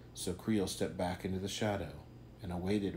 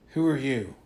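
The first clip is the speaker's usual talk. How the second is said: loud, raised demand; sharp challenge; 5 semitones higher, 8 dB louder than usual. Acted. Creel challenges the mysterious stranger, loud and sharp. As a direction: bark the question at the stranger.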